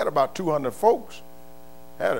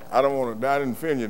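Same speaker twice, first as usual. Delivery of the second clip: usual speaking voice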